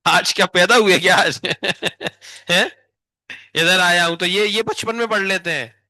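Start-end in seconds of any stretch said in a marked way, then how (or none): 0.1-2.7 teasing question that breaks into a laugh; laughing tease. Real, not acted. Teacher laughing as she teases a student who just arrived. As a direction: laugh while mocking the latecomer.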